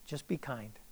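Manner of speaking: very soft, low voice; hushed divine prompting. Retold or imitated. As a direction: give gentle counsel under your breath. Voices the Lord's quiet prompting.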